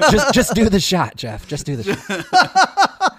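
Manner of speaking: loud, high goading voice; hammed-up high-pitched urging; shoulder devil. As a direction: egg a drinking buddy on. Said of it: "two devils on his shoulder".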